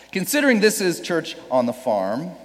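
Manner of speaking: ordinary delivery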